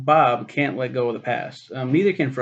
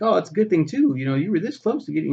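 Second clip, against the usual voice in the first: smug boss; 6 semitones higher, 4 dB louder, faster than usual. Retold or imitated. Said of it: "the guy's like".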